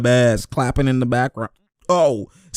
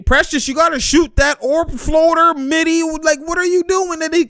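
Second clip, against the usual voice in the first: much higher, half laughing in disbelief; incredulous yelp; 14 semitones higher than usual. Real, not acted. Fan shouting at the player about a bad shot, high and incredulous. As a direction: squeal at the missed floater.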